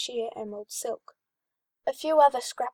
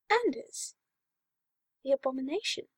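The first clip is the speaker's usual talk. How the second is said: incredulous; 3 semitones higher than usual. Acted. Sebastian incredulous.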